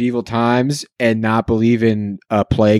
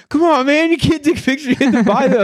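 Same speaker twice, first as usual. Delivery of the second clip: much higher, whiny protest; mock scolding vendor; faster than usual. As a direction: whine that he must pay. Photo seller scolding.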